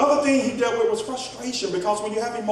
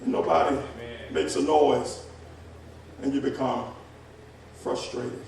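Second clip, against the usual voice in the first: dropping quiet; 9 semitones lower, 8 dB quieter than usual. Real, not acted. Quiet drop after shouting.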